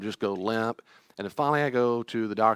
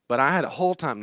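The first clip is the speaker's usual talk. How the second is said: normal talking voice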